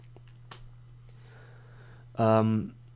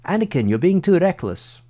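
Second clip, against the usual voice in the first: put-on Ewan McGregor accent; 7 semitones higher, 9 dB louder than usual. Streamer quoting Obi-Wan scolding Anakin, mock British accent. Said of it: "I'm getting a funny accent on that".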